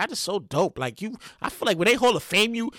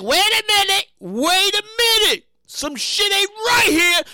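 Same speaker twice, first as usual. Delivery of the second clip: higher, slow, wary voice; drawn-out suspicious alarm; suspicious bank; 7 semitones higher, slower than usual. Retold or imitated. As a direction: stretch it like spotting fraud. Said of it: "bank and the credit card company like".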